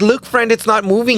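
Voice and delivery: high, playful talking to her own toe; mock sweet talk to toe. Talking to her toenail, high and playful. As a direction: coo it at the toenail like a friend. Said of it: "I was like".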